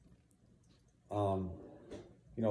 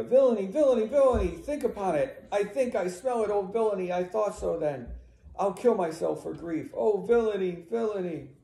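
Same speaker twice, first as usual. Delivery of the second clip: high, loud cries of outrage; upset; 8 semitones higher, 5 dB louder than usual. Acted. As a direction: cry out in horrified realization. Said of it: "She's upset".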